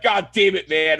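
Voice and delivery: mild mock yell; retold yelling. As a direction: imitate someone yelling in frustration. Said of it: "yelling at this guy".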